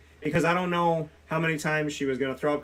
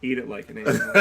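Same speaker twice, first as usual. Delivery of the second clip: laughing; 5 dB louder, faster than usual. Real, not acted. Laughs through the punchline.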